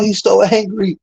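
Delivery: taunting. Acted. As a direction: tease him in a high gleeful voice. Taunting the opponent, laughing.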